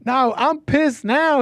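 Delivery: high, slow mock outrage; mocking the caller. Host mimics the angry caller's outburst, high and slow. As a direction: ham up his indignant squeal. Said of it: "he says".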